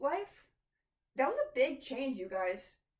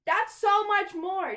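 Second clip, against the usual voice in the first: much louder and higher, slow and stretched; emphatic exclamation; 7 semitones higher, 11 dB louder, slower than usual. Real, not acted. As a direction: hammer home how big the pile got. Pointing to the growing pile.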